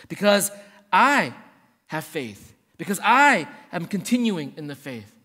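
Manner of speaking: "I" hit loud and held, the rest dropping; stress on I. Acted. Preacher voicing the wrong, first-person answer, stress on I. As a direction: lean hard on each "I".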